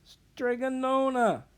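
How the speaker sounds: high, loud wail of her name; weeping. Acted. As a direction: cry her name out in grief. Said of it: "wept Antonia".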